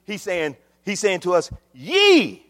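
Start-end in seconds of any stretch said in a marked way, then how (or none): 1.7-2.4 "Ye" pitched high and punched loud; forceful, ringing "Ye"; emphatic YE. Acted. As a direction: hit "Ye" hard, stretched out. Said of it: "He is very emphatic".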